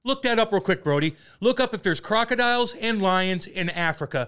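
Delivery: loud, high-pitched indignant outburst; real exasperated shouting; heated disbelief. Real, not acted. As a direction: argue it at full volume, incredulous. Heated argument over animal facts.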